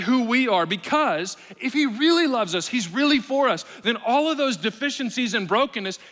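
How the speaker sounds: high, loud, urgent preaching; preaching at full force. Acted. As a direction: drive it home to the congregation.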